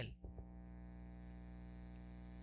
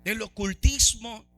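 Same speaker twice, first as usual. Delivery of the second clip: rising into full-force preaching, louder; 4 semitones higher, 4 dB louder than usual. Acted. Preacher exhorting the church, full-force preaching. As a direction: pound the congregation with conviction.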